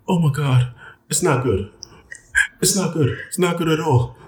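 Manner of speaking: high-pitched imitation of a dismayed partner; retold horrified reaction, high. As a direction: act out her dismay, pitch up.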